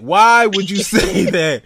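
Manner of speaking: high, loud protest through laughter; laughing disbelief. Real, not acted. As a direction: drag out the question as you crack up. Cracking up, scolding friend's remark.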